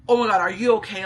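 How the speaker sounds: loud, urgent acted concern; retold burst of alarm; alarmed grandpa. Grandpa rushing to granddaughter, acted alarm.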